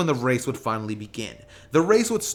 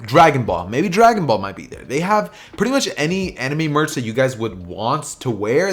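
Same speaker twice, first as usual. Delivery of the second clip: louder, upbeat ad read; sponsor read; 7 dB louder than usual. Acted. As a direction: sell the merch store to your viewers.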